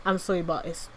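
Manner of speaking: ordinary talk, nothing marked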